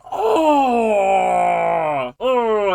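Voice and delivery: loud, long frustrated wail; angry groan. Real, not acted. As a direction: howl with exasperation, then groan. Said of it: "it's making me so angry".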